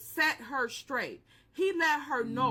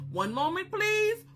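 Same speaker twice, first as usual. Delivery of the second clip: phone operator; 7 semitones higher than usual. Retold or imitated.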